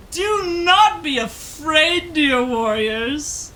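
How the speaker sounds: slow, loud divine proclamation from the cliffs. Acted. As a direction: boom it out like a grand thunder god. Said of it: "picture Zeus from Epic".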